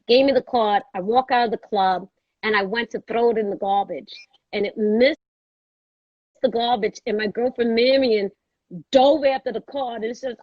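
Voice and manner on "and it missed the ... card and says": ordinary delivery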